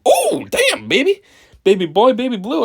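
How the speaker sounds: high, excited catcalling; mock hype. Teasing Jerry's outfit with high excited hype. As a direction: hype up the baby blue outfit.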